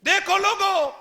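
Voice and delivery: loud, high wail; anguished cry. Retold or imitated. Son crying out to the gathered people, loud anguished wail. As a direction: cry it out to the crowd.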